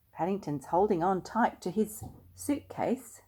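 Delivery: ordinary delivery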